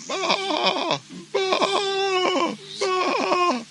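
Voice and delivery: high, loud bleating; sheep bleat. Acted.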